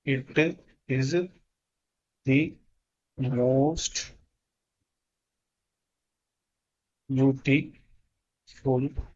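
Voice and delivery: very slow, one word at a time, soft; word by word dictation. Shown as a demonstration. Teacher dictating to the class word by word, very slowly. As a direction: spell it out for students copying it down. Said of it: "मैं जल्दी से लिख लेता हूँ".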